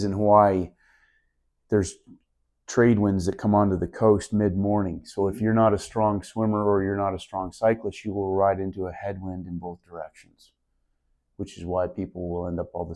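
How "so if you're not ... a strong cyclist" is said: usual speaking voice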